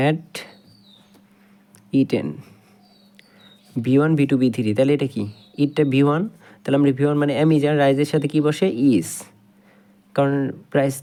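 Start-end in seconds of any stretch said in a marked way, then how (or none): none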